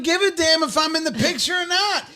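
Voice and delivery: high, fast sassy retort; sassy defiant. Acted. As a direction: toss it off like you don't care. Defiant joking rant.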